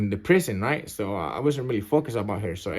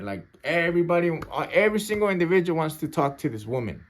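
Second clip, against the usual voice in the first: ordinary talk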